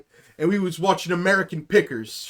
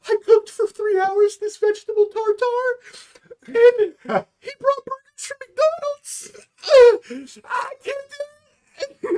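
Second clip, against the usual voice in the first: much higher, hammed-up sobbing wife with a cracking voice; mock crying; 4 dB louder, slower than usual. Mocking the vegan mom sobbing in the bathroom over the burgers, high and teary. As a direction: whine it out like a weeping wife, slowing at the end. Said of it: "the mom's in the bathroom crying".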